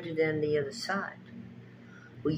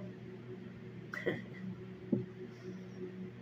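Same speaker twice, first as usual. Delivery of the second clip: soft breathy laugh; 12 dB quieter than usual. Real, not acted. Laughs after teasing question.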